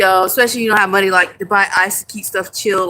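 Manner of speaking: ordinary delivery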